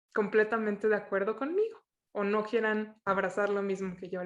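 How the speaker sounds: normal talking voice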